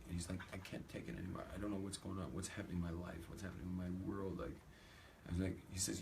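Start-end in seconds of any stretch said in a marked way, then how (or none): 0.5-4.4 soft, mildly tearful retelling; understated mock despair; mock crying. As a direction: imitate a man breaking down, quietly and half-hearted. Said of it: "He starts crying".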